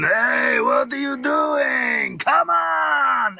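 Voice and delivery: loud, high, hammed-up Russian accent; booming Russian accent, slow and shouted; Russian giant voice. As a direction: bellow it like a huge Russian gladiator. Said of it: "I'm doing the Russian".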